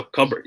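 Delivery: cupboard said as KUB-erd, the P left silent; teacher models cupboard with no P sound. Shown as a demonstration. Teacher showing students the right way: silent P. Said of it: "We don't pronounce the P".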